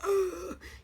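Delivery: a sharp, high staged gasp; mock gasp. The statue lover gasping with longing, a high mock gasp. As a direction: gasp like someone who can't hold still. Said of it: "this looks like gasping".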